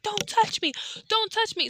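shrill retold cry of someone pushing a man away; frantic scream. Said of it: "She's like".

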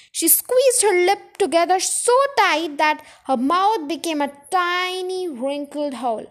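ordinary delivery, nothing marked

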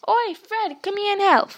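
high, loud call across the carriage; hollering for Fred over the platform noise. Acted. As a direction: shout for your brother to come help. Twin calls to brother.

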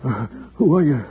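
very low, faint, halting words; weak and dazed. Acted. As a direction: speak haltingly, just coming round. Rescued man barely conscious.

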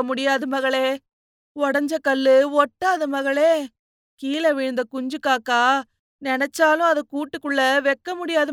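put-on plaintive, keening older woman; sobbing lament. A narrator's reading. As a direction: mourn aloud to a daughter. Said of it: "கட்டிப்பிடித்துக் கொண்டு கேவினாள்".

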